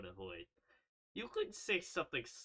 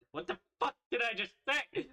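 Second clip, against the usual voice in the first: higher, louder startled outburst; shocked outburst; 10 semitones higher, 6 dB louder than usual. Real, not acted. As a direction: blurt it in disbelief. Shocked at herself.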